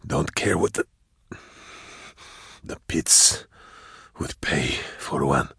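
low, soft, slow and halting; ragged dying voice. A narrator's reading. Dying hunter confessing to Drusha, ragged and weak. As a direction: labor out the words with long gaps. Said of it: "his breathing was ragged".

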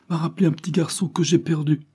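high, tearful pleading plea; a voice close to tears; choked, high, begging for understanding; sobbing. A narrator's reading. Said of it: "ajouta-t-elle en sanglotant".

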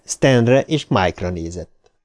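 usual speaking voice

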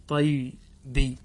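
teacher repeating the answer slowly for the class; drawn out slowly. Shown as a demonstration. Teacher to students, stretching the form slowly. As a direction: say طيبي very slowly, lingering on every sound. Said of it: "فصارت طيبي".

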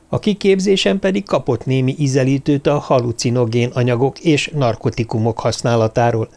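ordinary delivery

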